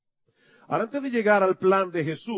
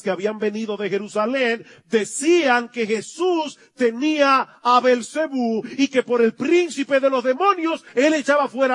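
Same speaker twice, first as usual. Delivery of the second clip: louder, higher preaching build; fiery pulpit crescendo; preaching at full force; 5 semitones higher, 6 dB louder than usual. Real, not acted. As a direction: rise to a fervent climax.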